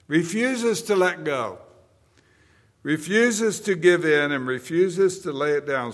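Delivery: booming sermon delivery; full force preaching. Acted. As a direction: hammer each line home to the congregation.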